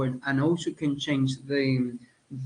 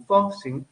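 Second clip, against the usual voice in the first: "fossil" with FOS raised, then falling; stress on first syllable; 5 semitones higher, 3 dB louder than usual. Shown as a demonstration. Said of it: "changed from the first l-, syllable".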